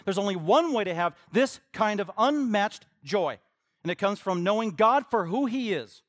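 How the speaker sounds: loud, high, driving sermon delivery; full-force preaching. Real, not acted.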